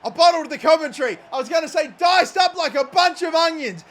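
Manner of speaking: shouting in goal joy; excited shout. Real, not acted. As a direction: yell it out, thrilled. Goal celebration commentary.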